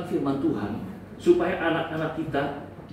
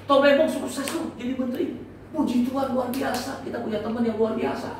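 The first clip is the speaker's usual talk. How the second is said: nada tinggi, kagum pada teman; ikut bangga; 7 semitones higher than usual. Acted. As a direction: peragakan rasa senang atas sukses teman. Memperagakan ikut senang teman sukses. (ikut bangga, suara meninggi)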